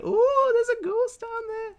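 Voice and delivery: high, slow, hammed-up spooky wail; a loud, campy ghost-story voice. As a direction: lure them down with a mock haunted moan. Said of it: "kept trying to lure people down into the basement and being like".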